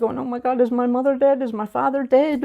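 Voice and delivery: high, urgent mock panic; retold alarmed sister. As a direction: imitate a sister fearing terrible news, pitch jumping up. Sister fears a family death.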